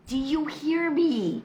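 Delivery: loud call to husband in another room. Real, not acted. Wife calling out to her silent husband, loud and slow. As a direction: call out to check he is listening.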